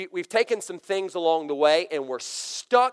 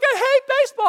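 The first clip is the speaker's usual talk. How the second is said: put-on high, loud child's pout; sulky hurt boy; 7 dB louder than usual. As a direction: whine it like a kid who just quit. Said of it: "He's like".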